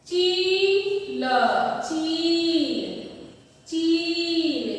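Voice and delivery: चील with the ee held very long; long vowel. Shown as a demonstration. Teacher drilling the long matra, चील with drawn-out ee. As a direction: stretch out the middle ee of चील each time. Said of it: "बड़ी ई मुंह से निकल रहे हैं ना".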